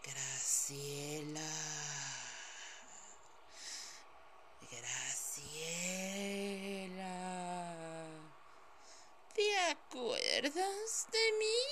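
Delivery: slow, layered voice from beyond the grave; cold hissing layered whisper. Acted. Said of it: "una voz de ultratumba".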